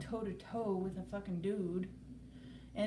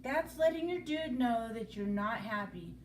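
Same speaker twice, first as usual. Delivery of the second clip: normal talking voice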